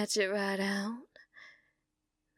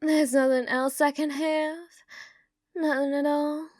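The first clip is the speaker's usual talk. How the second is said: ordinary talk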